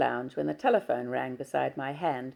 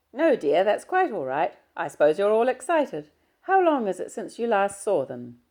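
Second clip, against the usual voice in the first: put-on kindly older employer, higher and louder; Mrs Muir's voice; 6 semitones higher, 7 dB louder than usual. Employer reassures Diana about the visit, kindly and raised. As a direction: reassure the girl, brisk and kind.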